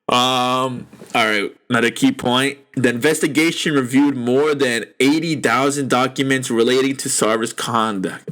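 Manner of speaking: normal talking voice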